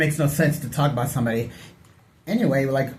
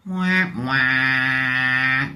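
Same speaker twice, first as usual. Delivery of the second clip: mock crybaby wah. Mocking crybaby.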